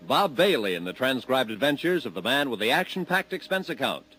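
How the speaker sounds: normal talking voice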